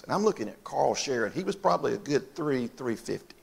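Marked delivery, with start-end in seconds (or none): none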